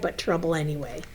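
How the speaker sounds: ordinary delivery, nothing marked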